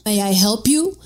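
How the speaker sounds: loud British butler interrupting; British butler's accented offer of service. A narrator's reading. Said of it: "the baker interrupts like a British butler".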